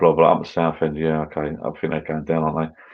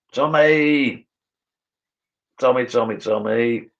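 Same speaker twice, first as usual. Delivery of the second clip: higher calling out of a name; 6 semitones higher, slower than usual. Real, not acted.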